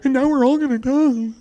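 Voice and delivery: high, breathless mock panic; panicky young person. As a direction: play the hysterical kid who believes everything.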